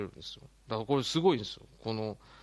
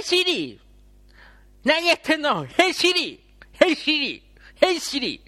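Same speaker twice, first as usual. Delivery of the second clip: much higher and louder underbite call; jutting jaw voice; 13 semitones higher, 9 dB louder, slower than usual. Shown as a demonstration. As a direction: push the jaw out and shout. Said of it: "もう一回やらせてください".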